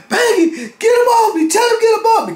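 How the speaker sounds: very high, loud pleading cry; frantic high shout; imitated desperate holler. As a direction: mimic a man pinned down begging for help. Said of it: "So he hollered".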